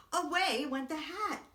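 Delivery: ordinary delivery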